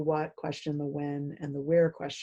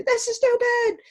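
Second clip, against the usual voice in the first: much higher, louder defensive student snapping back; defensive and aggressive; 5 dB louder than usual. Retold or imitated. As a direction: throw it at the teacher defensively. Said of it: "defensively and sort of aggressively".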